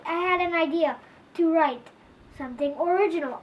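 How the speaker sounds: plain reading voice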